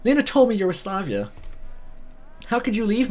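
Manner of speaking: a touch of tearful pleading; mild heartbroken girl voice. Acted. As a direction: voice a crying girlfriend, understated. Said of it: "She began to cry with a broken heart".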